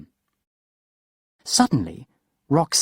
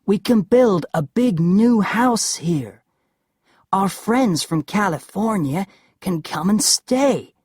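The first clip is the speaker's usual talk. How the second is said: Larry, coaxing husband; 8 semitones higher, 4 dB louder than usual. A narrator's reading.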